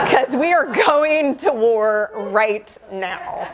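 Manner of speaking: slow, laughing battle cry; laughing declaration. Real, not acted. Patient declaring war on cancer, laughing and slow. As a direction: say it slowly while still laughing.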